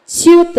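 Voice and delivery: च्युत said as one crisp syllable, chyut; careful syllable. Shown as a demonstration. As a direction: clip च्युत short, ch-yut. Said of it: "तो च्युत".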